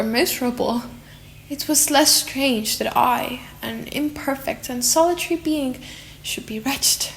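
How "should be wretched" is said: hushed, breathy whisper on the last words; whispered wretchedly. A narrator's reading. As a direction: let it sink to a whisper of despair. Lonely creature's sad admission.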